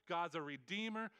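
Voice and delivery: ordinary talk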